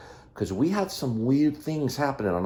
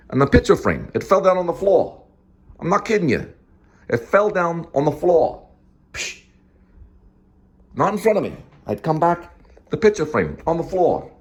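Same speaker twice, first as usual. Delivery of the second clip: lightly put-on New York accent, raised pitch; 7 semitones higher than usual. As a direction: tell it like a New Yorker, lightly. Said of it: "I'm gonna do this in a New York accent".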